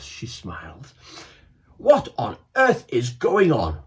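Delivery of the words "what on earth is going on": loud, indignant head teacher; bellowing. Acted. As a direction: bellow across the library. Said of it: "bellowed Mister Amitri".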